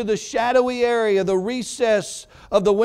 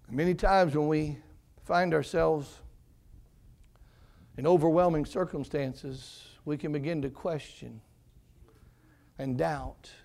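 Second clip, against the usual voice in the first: ordinary talk, nothing marked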